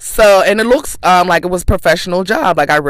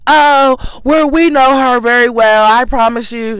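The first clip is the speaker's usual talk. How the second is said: mocking HR lady; 5 semitones higher than usual. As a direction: imitate her fake-sweet reassurance. Mimicking the HR lady.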